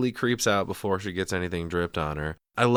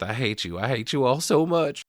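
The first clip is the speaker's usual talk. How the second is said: mock hateful outburst; 6 semitones higher, 4 dB louder than usual. Quotes hysterical Lorraine's last words.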